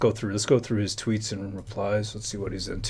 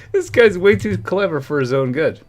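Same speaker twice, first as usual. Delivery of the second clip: ordinary delivery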